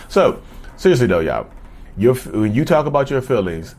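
ordinary talk

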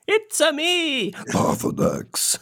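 high drawn-out Mario call dropping to a slow dragon rasp. Acted. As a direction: start squeaky-jolly, plunge into voiceless dragon growl. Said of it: "he does talk like that".

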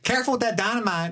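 very high Southern voice; Southern Dementor. Pretend. Dementor warning guests about dynamite, high Southern accent. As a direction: caution them in a comic high twang. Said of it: "I love the Southern Dementor bit".

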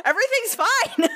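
high-pitched squealed reassurance; panicked squeal. Real, not acted. Friend reassuring after a false scare, squealing.

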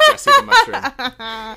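laughing, high and loud. Real, not acted. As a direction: laugh it out, teasing. Laughing joke retort.

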